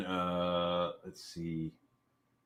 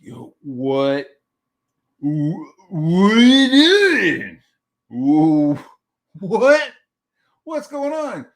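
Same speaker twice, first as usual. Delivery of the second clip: high, hammed-up drawn-out wail; flabbergasted parent crying out, breaking into squeals; shocked disbelief; 8 semitones higher, 10 dB louder, much slower than usual. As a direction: splutter in loud mock horror. Retold shock at muddy kids.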